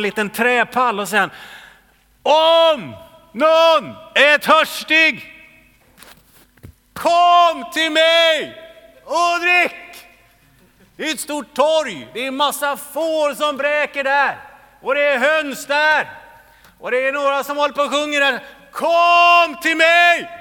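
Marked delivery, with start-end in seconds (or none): 2.2-9.8 much higher and louder, slow calling out. Acted. Preacher playing Jesus calling from a stool to the crowd. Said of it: "stod Jesus och han ropade". 11.0-18.4 higher and louder, shouting over a crowd; loud calling. Acted. Preacher describing the noisy square at full shout. 18.7-20.3 much higher and louder, slow calling out. Acted. Preacher playing Jesus calling from a stool to the crowd. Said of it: "så jag inte gapar hela tiden".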